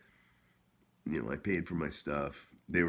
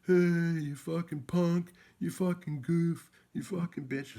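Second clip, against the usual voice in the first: very high, slow, loud yell across the street; hammed-up screaming insults; 14 semitones higher, 6 dB louder, slower than usual. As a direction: bellow it from a car window. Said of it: "He's like".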